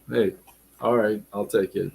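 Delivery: ordinary talk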